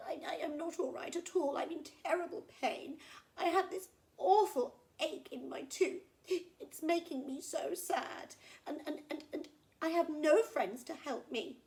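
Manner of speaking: high, loud, lamenting crocodile; pained, sad crocodile. Acted. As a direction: wail about a toothache, stammering and self-pitying. Voices the crocodile in pain.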